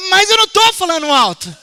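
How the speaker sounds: loud insisting denial; loud protest. Retold or imitated. Preacher retells replying to his wife, loudly insisting. Half-deaf man denies being loud.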